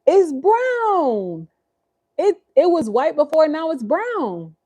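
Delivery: put-on high sing-song voice for a toddler; baby talk. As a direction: coo it up high to the little one. Talking to the baby.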